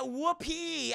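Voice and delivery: high, drawn-out whoopee; playful light celebration; mock cheer. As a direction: cheer in a high voice without extra volume. Mock cheer at season end.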